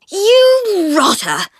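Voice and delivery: high, loud, slow furious outburst; enraged girl cursing her tormentor; fuming. Acted. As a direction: spit the insult out slowly. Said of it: "fumed the icicle of the fourth".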